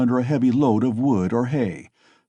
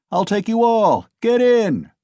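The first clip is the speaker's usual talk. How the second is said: loud, high boastful shout; 11 semitones higher, 5 dB louder than usual. Acted. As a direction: bellow it, showing off to the crowd. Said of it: "shouted one of them".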